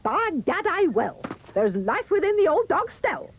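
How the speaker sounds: high, loud, defiant mother; cried out. A narrator's reading. Mother taking up Jack's dare, defiant cry. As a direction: declare it boldly, pitched high. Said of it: "the mother cried".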